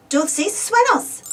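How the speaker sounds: "Dulces sueños" said slowly, DUL-ces SUE-ños, Spanish vowels; slow, clear Spanish "Dulces sueños"; Spanish phrase. Shown as a demonstration. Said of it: "In Spanish, we say".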